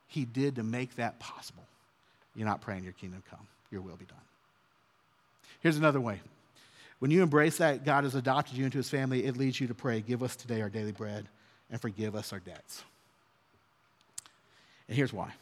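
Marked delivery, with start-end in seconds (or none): 2.8-4.2 hushed, much softer and lower prayer line; softly reciting the prayer to God. Acted. Quoting the Lord's Prayer. 10.0-12.8 hushed, much softer and lower prayer line; softly reciting the prayer to God. Acted. Quoting the Lord's Prayer.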